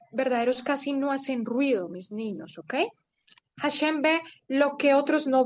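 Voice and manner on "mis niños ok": hushed. Shown as a demonstration. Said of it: "Lehrerin spricht leise".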